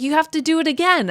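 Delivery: high, fast mock receptionist; bossy clerk, rattled off fast; mock staff voice. Comic retelling of the front desk, mock bossy and fast. Staff insisting, retold in stand-up.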